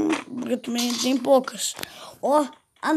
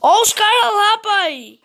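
sudden high, loud alert; alarmed callout; 6 semitones higher, 5 dB louder, slower than usual. Real, not acted. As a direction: call out the enemy fast. Spotting enemies. (alerta de inimigo, agudo e alto)

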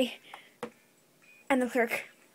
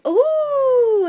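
normal talking voice

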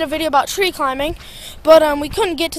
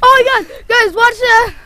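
excited; 5 semitones higher than usual. Real, not acted. Excited after trampoline stunt.

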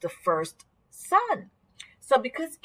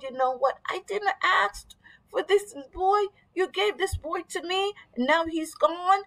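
upset mother; 8 semitones higher than usual. Acted. Mother complains to Elisha.

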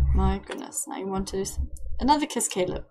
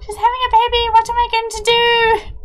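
high, louder mock panic of a new father; hammed-up freak-out, pitched way up; panicking dad; 12 semitones higher, 8 dB louder than usual. Streamer voicing Caleb as a panicking new father, high and louder. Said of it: "cute as a parent freaking out".

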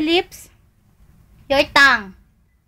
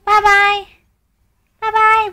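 sing-song bye-bye; 6 semitones higher than usual. Shown as a demonstration. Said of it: "Say bye-bye".

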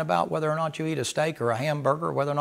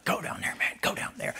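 whispered, urging push; urgent whisper; 10 dB quieter than usual. Retold or imitated. The soul whispering to the flesh to go down to the altar. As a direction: coax him forward under your breath.

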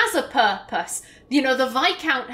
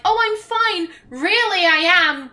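bright, much higher fake-fine voice; forced cheer; 6 semitones higher, 4 dB louder than usual. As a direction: pretend she's fine, covering the hurt. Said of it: "with a smile that doesn't really reach her eyes".

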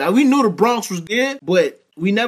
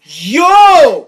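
sharp loud "Yo!" to cut in; loud protest; 5 semitones higher, 9 dB louder than usual. Real, not acted. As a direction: yell to stop him talking. Cuts in to protest.

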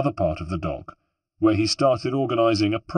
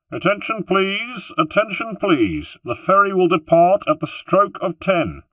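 high-pitched call to a whole crowd; ringing public ferry announcement; louder announcement; 10 semitones higher, 3 dB louder than usual. A narrator's reading. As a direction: announce it loudly to all the passengers. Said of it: "In a louder voice, directed at the crowd".

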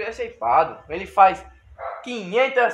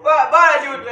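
loud encouraging call; cheering shout; 5 semitones higher, 6 dB louder than usual. Real, not acted. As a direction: yell to push him on. Cheering friend on push ups.

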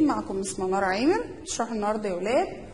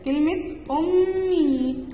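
drawn-out example word أمي, slowly stretched; 4 semitones higher, much slower than usual. Shown as a demonstration. Teacher to first graders, slowly drawing out the example. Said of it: "كده مثال مع بعض".

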